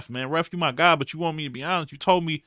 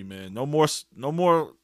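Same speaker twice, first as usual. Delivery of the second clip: normal talking voice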